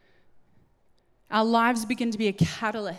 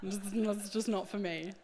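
mock prim Jesus; 3 dB louder than usual. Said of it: "being like".

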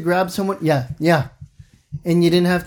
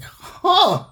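mock surprise; 13 semitones higher than usual. Mock reaction to autocorrect.

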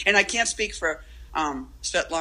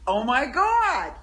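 mock awed; 7 semitones higher, 3 dB louder, slower than usual. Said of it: "that thing where you're like".

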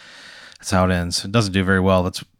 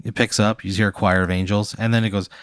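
ordinary delivery

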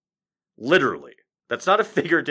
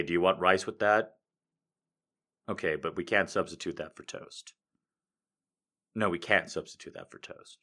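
lower, soft flat service voice; bored waiter; 8 semitones lower, 12 dB quieter than usual. Pretend. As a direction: drone through the order. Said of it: "he's all like".

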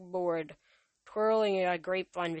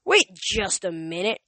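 growled; 8 dB louder than usual. A narrator's reading. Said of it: "Ares growled".

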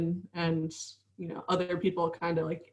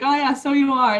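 higher, louder imitated Cork lilt; sing-song Cork accent; 6 semitones higher, 6 dB louder than usual. Showing the host how Cork people talk, sing-song Cork accent. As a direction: mimic a sing-songy Cork local. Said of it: "It's very sing-songy".

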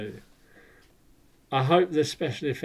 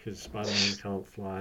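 normal talking voice

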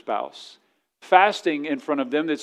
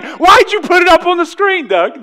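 mock accusation; 12 semitones higher, 9 dB louder than usual. Teasing the tech guy aloud.

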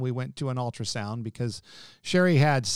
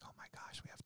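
hushed, breathless whisper of surprise; 25 dB quieter, faster than usual. Retold or imitated. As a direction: whisper it in awe at the screen. Seeing twins on the ultrasound.

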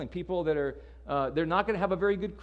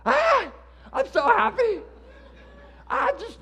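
much higher and louder, a giddy mock squeal; fake gushing happiness; 8 dB louder, slower than usual. Mocking Christians who act always happy, giddy squeal. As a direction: ham up a grinning over-the-top cheer. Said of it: "does that mean we're always walking around going".

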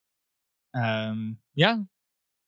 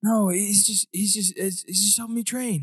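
put-on higher, stammering boy's voice; nervous boy; 8 semitones higher than usual. Scared boy defends his bully to the ranger, higher and stammering. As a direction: stumble over the words like a scared kid.

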